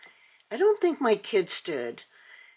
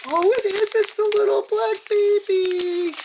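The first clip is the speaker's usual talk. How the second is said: very high, louder squeal of joy; ecstatic squeal; 14 semitones higher, 4 dB louder than usual. Real, not acted. Showing viewers the baby she hoped for, squealing very high. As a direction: squeal like your wish came true. Discovers the doll she wanted most.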